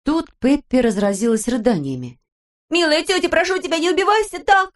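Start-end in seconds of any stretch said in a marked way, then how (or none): 2.7-4.7 tearful plea. A narrator's reading. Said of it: "крикнула она, не переставая всхлипывать".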